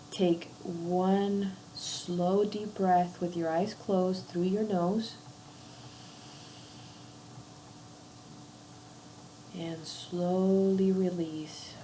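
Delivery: slow, drawn-out meditation guide; unhurried breathing cues with long pauses; guided breathing meditation. Acted. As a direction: lead a breath slowly, letting each phrase fade. Leads a breathing exercise.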